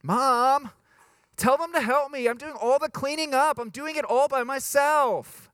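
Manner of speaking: high, loud sibling complaint to mom; whiny kid tattling. Acted. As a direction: yell for mom like an aggrieved child. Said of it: "that sounds like my sister or my brother".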